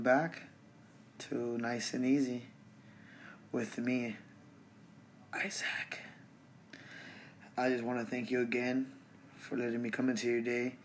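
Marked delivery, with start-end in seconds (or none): 5.3-6.0 whispers his name in the intro; hushed signature sign-off. Real, not acted. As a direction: breathe the name like a secret.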